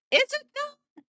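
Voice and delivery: very high skeptical squeak; high mock-skeptical question; mock doubt. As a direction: squeak it up in disbelief.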